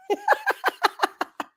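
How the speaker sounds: high squealing laugh; high cackle. Real, not acted. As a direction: break into a shrill cackle. Laugh after quoting Rose.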